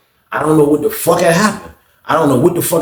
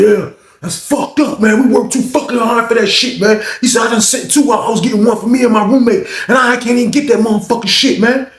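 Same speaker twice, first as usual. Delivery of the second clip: aggrieved complaint; 9 semitones higher than usual. Retold or imitated. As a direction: whine it out like you got robbed. Lawrence complaining about holiday pack.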